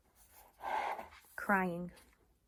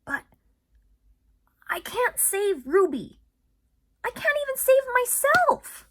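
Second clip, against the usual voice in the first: despair; 12 dB louder than usual. Acted. Ivan despairing about Ruby, anguished cry. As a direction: cry out your hopelessness.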